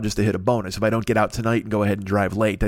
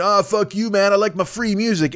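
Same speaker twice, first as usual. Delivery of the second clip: higher-pitched scoffing imitation of a freeloader; mock defiance; 7 semitones higher than usual. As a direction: play a defiant downloader, pitched up. Mimics defiant music downloaders.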